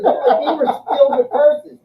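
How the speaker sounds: high, loud, laughing boast; laughing brag. Retold or imitated. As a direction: crow it while laughing. Bragging to girl about loot.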